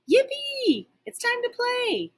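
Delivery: high, squealing puppy delight; bursting with playtime glee; excited cheer. Acted. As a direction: yelp it out like a thrilled pup. Dog cheering in the park.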